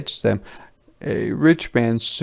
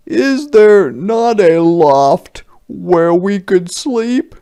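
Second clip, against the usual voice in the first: higher, louder humble soldier; soldiers asking; 9 semitones higher, 9 dB louder than usual. A narrator's reading. Tired soldier asking peasants for a loft, higher and louder. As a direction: ask sweetly for a place to sleep. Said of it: "the three soldiers asked".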